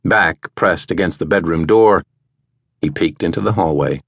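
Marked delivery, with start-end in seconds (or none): none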